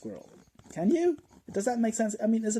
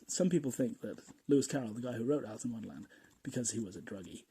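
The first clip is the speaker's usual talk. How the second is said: ordinary talk, nothing marked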